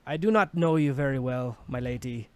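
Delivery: a hint of an old dwarf, proud and tender; dwarf's farewell words. A narrator's reading. Dwarf speaking into Kratos's and Addie's heads, proud tender farewell. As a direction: give a dying mentor's blessing. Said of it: "he talks to Hades".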